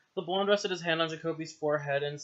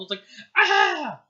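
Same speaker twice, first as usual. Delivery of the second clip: higher, louder drawn-out ah; demo scream; 11 semitones higher, 9 dB louder than usual. Shown as a demonstration. Reader showing how the cry goes. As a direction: show how the ow should land. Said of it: "a period after ow".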